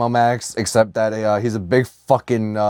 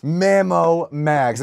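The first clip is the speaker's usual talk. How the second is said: drawn out; 5 semitones higher, much slower than usual. Pretend. Echoes drawn-out name.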